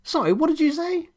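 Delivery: tinny faraway walkie-talkie voice; thin distant radio voice. A narrator's reading. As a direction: sound far off through a crackly speaker. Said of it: "George's thin and distant reply through the radio's speaker".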